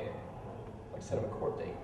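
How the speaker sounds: whispered, barely audible repeat; very soft, voiceless aside; hushed repeat. Acted. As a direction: say it under your breath. Repeats own line under breath.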